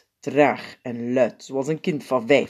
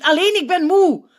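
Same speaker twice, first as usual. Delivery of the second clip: exasperated correction; 8 dB louder than usual. A narrator's reading. Opperpiet correcting Sint.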